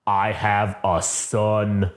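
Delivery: slow, low, lightly voiced Vader; a hint of awed Vader, slowly. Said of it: "he just says".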